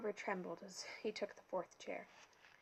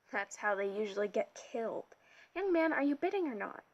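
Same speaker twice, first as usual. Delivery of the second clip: impatient director; 7 dB louder than usual. A narrator's reading. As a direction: push him to bid, fed up. Director presses the boy.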